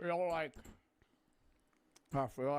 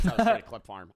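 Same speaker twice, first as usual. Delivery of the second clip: laughing; 14 dB louder than usual. Real, not acted. Laughing at clip farm moment.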